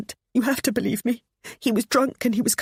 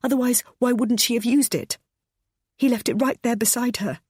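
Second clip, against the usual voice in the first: raised, distressed confession; crying out; 7 semitones higher than usual. Acted. Pammy confessing to James and narrator, distressed. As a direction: plead your case through tears. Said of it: "she cried".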